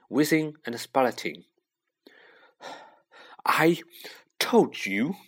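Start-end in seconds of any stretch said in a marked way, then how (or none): 3.5-5.2 halting gasp between words; out of breath, breaking up the phrase. A narrator's reading. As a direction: gasp it out in pieces. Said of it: "Hermione gasped".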